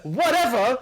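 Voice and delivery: incredulous, offended repeat of whatever; outraged echo. Retold or imitated. Boss repeating the coworker's word in laughing outrage. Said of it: "And he's like".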